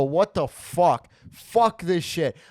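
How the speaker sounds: slow, loud, stretched cursing; mock yelling. Retelling the London parking lot, slow loud cursing. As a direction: drag out the curses in fury. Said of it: "yelling and screaming".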